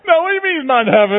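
loud, high, fast hammed-up protest through a laugh; mock outraged insistence. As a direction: overplay the indignant lie, laughing.

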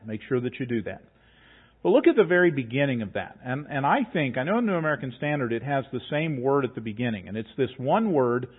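ordinary talk, nothing marked

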